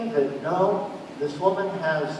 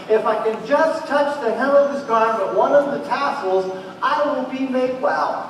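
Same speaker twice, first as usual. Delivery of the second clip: rising, louder, pleading resolve; desperate hope; 6 semitones higher, 4 dB louder than usual. Retold or imitated. The woman tells herself she'll be healed, urgent. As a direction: say it as her last chance, building higher. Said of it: "and she tells herself".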